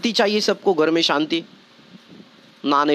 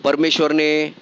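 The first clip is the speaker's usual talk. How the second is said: low, steady sermon exhortation; preacher pressing the point home; earnest preaching; 5 semitones lower than usual. Acted. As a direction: urge the congregation to sow goodness. Preaching exhortation, lower pitch.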